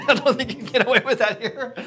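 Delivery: loud, high, laughing aside. Real, not acted. Speaker joking to the audience about the makeshift shower, laughing out loud. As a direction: say it through a big laugh. Laughing at his own joke.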